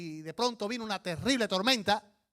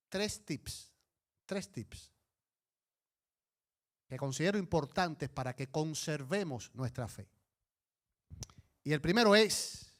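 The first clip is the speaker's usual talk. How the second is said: ordinary delivery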